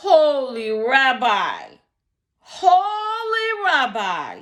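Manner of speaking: high, loud, drawn-out shriek. Acted. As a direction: shriek it in utter despair. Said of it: "he shrieked".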